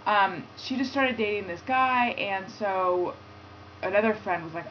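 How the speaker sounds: ordinary talk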